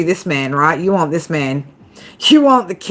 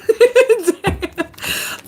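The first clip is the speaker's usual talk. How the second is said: high-pitched laugh; 12 semitones higher than usual. Real, not acted. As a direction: break into a laugh. Laughs after the [name] joke.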